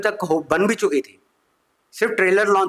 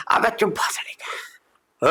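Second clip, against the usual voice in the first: slow, breathy villain hiss; mock villain snap; 4 semitones higher, 3 dB quieter, much slower than usual. Villain silences the comedian, slow mock hiss. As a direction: hiss it like a filmi goon.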